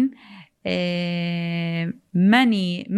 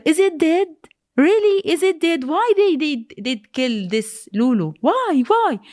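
high, disbelieving questions; distraught why, voiced high; retold anguished questioning; 6 semitones higher than usual. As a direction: re-enact desperate pleading, pitch jumping up. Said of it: "She asking her father".

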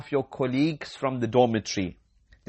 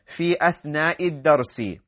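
ordinary talk

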